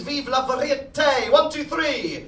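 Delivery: loud, drawn-out showman cry; magic words shout. Acted. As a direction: announce the magic words with flourish. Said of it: "the magic words".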